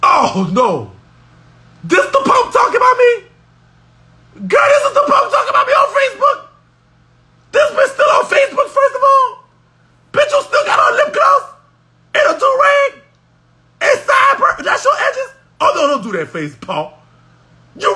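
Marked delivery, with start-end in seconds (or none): 1.8-6.5 high-pitched scandalized yell at the screen; outraged shriek. Real, not acted. Outraged reaction to the video. 7.5-15.3 mocking shout. Real, not acted. Mocking the man's look, shouting.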